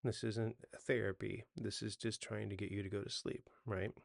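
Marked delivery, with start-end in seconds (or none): none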